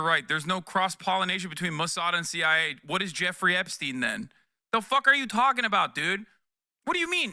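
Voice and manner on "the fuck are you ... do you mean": incredulous outburst. Real, not acted. Incredulous rant at chat.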